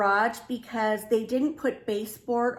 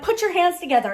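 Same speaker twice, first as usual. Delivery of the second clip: loud, higher retold order; bossy command; 6 semitones higher, 5 dB louder, faster than usual. Wife directing husband's hand washing, acted bossy command. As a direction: give orders, acting out the fuss.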